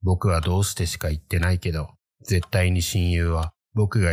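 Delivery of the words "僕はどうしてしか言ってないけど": plain reading voice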